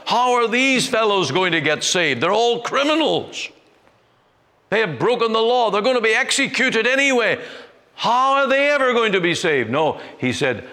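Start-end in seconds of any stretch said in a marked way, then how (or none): 0.0-9.7 scornful jailer. Retold or imitated. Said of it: "say to them".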